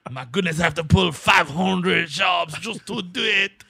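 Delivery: high, loud hammed-up French accent; bad French accent. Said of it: "That's why we were doing such a bad French accent".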